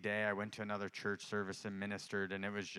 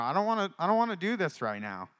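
mock reluctant whine, pitched up; retold whining; 8 semitones higher, 8 dB louder than usual. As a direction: plead like an unwilling child, louder. Retold reluctant plea to God.

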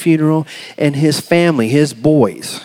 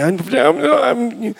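higher, strained stammer; can't get it out; 10 semitones higher, much slower than usual. Acted. Preacher acting himself unable to say sorry, stuck stammering. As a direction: struggle to force the apology out. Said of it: "That, that was me".

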